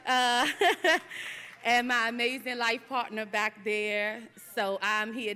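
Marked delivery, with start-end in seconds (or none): 0.5-1.6 a bright, high burst of laughter; real high laugh. Real, not acted. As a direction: laugh fondly at the family. Laughs at her crying son.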